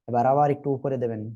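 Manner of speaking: ordinary delivery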